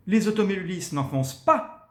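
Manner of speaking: high, loud "n'enfoncent pas"; accent on the negation. Shown as a demonstration. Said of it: "c'est là qu'il faut mettre l'accent".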